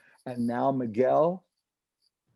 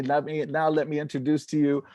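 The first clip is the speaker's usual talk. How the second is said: mock announcer intro; 3 semitones higher, 4 dB louder than usual. Shown as a demonstration. Joking host introducing a comedian, mock announcer hype. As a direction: hype it like an emcee, then cut yourself off. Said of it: "That was my intro".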